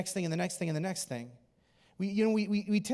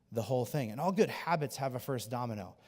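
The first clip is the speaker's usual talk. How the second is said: ordinary talk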